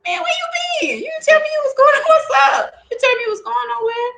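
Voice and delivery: high, put-out complaint to a brother; Ogun indignant. Acted. Ogun scolding brother Shango, high and aggrieved. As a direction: whine at him for not telling you. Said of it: "And Ogun is like".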